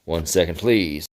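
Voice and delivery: ordinary talk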